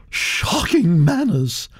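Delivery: put-on high, gossipy old aristocrat voice, pitched well up; elderly Duchess. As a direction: chatter on like a grand old lady. Duchess disapproves.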